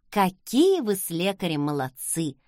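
ordinary delivery

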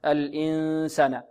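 الإنسان slow with the noon through the nose; nasal ikhfa. Shown as a demonstration. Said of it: "نضغط على الأنف". (غنة من الأنف)

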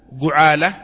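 جعالة said with a u after the jim; جعالة بضم الجيم; ju'ala with damma. Shown as a demonstration. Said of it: "بفتح الجيم وكسرها وضمها".

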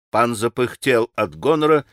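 ordinary delivery, nothing marked

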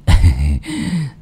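low smug laugh; low greedy chuckle. A narrator's reading. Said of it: "cười hành hạch".

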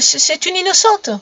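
put-on high, stammered child's plea; nervous lying boy. A narrator's reading. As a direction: falter while covering for her. Boy lies hesitantly to gendarme.

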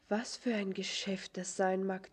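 ordinary talk, nothing marked

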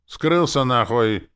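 slow, high, loud order to get lost; emphatic angry shout; forceful dismissal. A narrator's reading. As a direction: shout him away, stretching each word. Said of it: "я добавил для убедительности".

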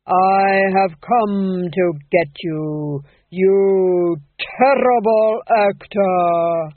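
very slow, loud, drawn-out doom; intoning as Death. A narrator's reading. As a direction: let each syllable fall into place. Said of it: "he intoned, each syllable falling into place".

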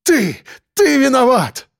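high-pitched angry cry of blame; flaring, wrathful outburst; furious accusation. A narrator's reading. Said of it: "вспыхнули гневом".

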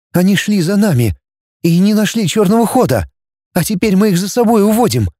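high, mildly anxious voice; trembling voice. Acted. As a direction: carry a slight tremble high in the voice. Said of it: "дрожащим голосом сказала Наташа". (высоко, с лёгкой дрожью)

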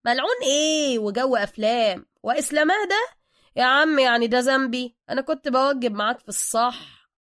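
loud, animated street-Egyptian chatter; lightly voiced Suka, brash. A narrator's reading. Suka consoling Bruce with his own hard luck, brash and loud. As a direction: rattle it off like a brash local pal.

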